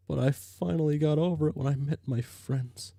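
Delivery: ordinary talk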